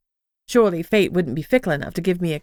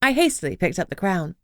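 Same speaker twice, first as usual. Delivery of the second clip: ordinary delivery, nothing marked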